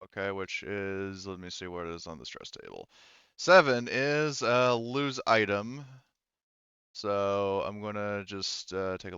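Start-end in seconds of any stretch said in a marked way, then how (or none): none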